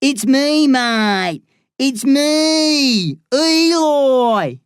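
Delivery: high, loud mocking Australian outback accent; thick Australian outback accent. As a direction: ham up a broad Australian outback drawl for the crowd. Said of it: "He adopts a thick Australian outback accent".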